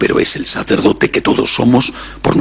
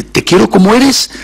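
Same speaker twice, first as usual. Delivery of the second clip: higher, louder, "te quiero como eres" pushed up; emphatic repeat; 9 semitones higher, 4 dB louder than usual. Retold or imitated. Preacher repeating words to God, more emphatic. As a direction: lift it with fervour on the repeat.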